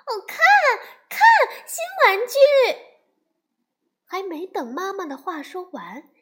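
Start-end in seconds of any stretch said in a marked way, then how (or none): 0.1-2.3 high, loud delighted cry of a little cub; excited shout. Acted. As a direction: squeal at the new toy. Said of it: "小熊妹妹已经大声喊起来". (小熊妹妹看到新玩具，兴奋地高声尖叫)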